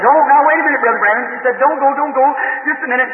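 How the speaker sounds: high-pitched, half-tearful mock plea; imitating a distraught woman begging him to wait; retold crying plea. Troubled woman begging the preacher not to leave, retold tearful high plea. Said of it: "She's crying".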